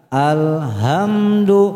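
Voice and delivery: "alhamdu" overlong in a chanting tone; wrong drawn-out syllables. Shown as a demonstration. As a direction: drag out "alhamdu", lingering on each syllable. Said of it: "jangan dibaca".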